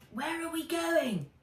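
a curious little dragon; Fizz's voice. A narrator's reading.

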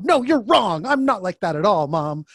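loud, high indignant protest; acted-out outburst of denial; mock defiant retort. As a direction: argue back at mom, hammed-up. Acted retort to a berating mother.

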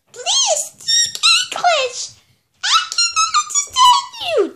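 very high, squeaky cartoon voice; pinched high voice pleading; high-pitched puppet character.

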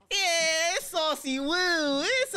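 high, drawn-out cheer; fan excitement. Real, not acted. Fan greeting the favourite character, excited high cheer. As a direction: stretch the name out in a high whoop.

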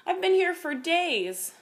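slow, high, pitiful complaint; woeful creature, drawn out; plaintive dragon. A narrator's reading. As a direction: moan about the long ordeal. Tearful trapped dragon explains plight.

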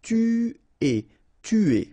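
Tuer with a rounded French u, loud; tu-ay, clear French u. Shown as a demonstration. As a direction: stress the u in Tuer. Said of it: "U is really pronounced like U".

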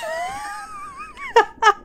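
very high, squealing laugh; thin high giggling after a curse; helpless laugh. Real, not acted. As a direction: laugh in exasperated disbelief. Laughs after cursing the game.

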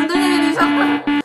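loud startled yell; startled cry. Real, not acted. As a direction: cry out in shock. Shock at game event.

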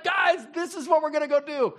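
high, loud rallying shout; shouting the game plan to the guys; excited shout. Retold or imitated. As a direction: fire up the team. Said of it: "He's like".

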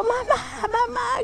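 high, tearful, slow cry of terror; crying for mom. Retold or imitated. Girl pulled by a ghost crying out for her mother, high and tearful. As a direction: sob out mom in panic.

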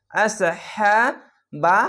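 আসহাবাস with a thick squeezed ain, slowly chanted; heavy throat letter. Shown as a demonstration. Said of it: "খাড়া জবর এক আলিফ টেনে পড়বেন".

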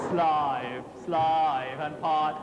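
"Slave, slave" said low as "slaive, slaive"; Cockney "slaive" on both "slave"; Cockney vowel. Shown as a demonstration. Chorus master drilling the choir on "slave" as "slaive". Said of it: "a slight slave rather than slave".

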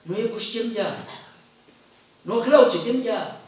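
ordinary talk

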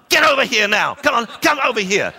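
high, loud barked order; angry command. Pretend. Speaker demos to audience a loud, high angry summons. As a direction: order the person next to you over, loudly. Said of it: "turn to someone in the row now and tell them".

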